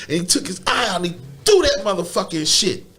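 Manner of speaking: ordinary delivery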